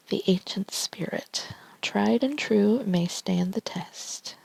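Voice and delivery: ASMR whisper reading tarot cards; hushed ASMR card reading. Real, not acted.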